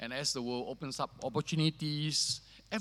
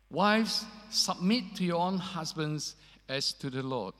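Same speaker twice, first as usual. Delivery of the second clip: scripture reading; 4 semitones higher than usual. Acted. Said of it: "Let me read that to you".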